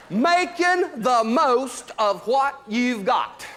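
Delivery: slow, high, loud shout of the motto. Acted. Comic to audience, shouting the motto slowly. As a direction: belt it out like a rallying cry.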